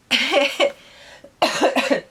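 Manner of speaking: high burst of laughter; loud laugh. Real, not acted. Laughs at own confession.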